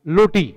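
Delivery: "लोटी" starting with L instead of R; mispronounced roti. Shown as a demonstration. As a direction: turn the R into L in "लोटी". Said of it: "के रूप में उच्चा-, उच्चारित करते हैं". ("लोटी": र को ल बोलकर)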